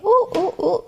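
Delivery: high ooh ooh ooh; mock alarm; mock-startled hoots; playful alarm. Real, not acted. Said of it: "startled playful".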